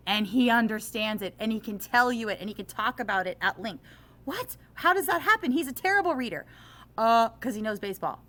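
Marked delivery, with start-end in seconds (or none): 4.2-6.4 much higher and louder, an incredulous squeal; mock disbelief. Teacher acting baffled that the poor reader understood, mock disbelief. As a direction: ham up a baffled teacher's shock.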